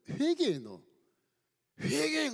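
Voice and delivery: high, loud cry to repent; shouted demand. Acted. Friends yelling at Job to repent, loud command. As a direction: bark it as a command.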